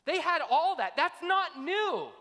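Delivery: loud, high, slow preaching peak; preaching shout. Real, not acted. Preacher shouting to the congregation. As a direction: thunder it out to the congregation. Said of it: "preaching climax".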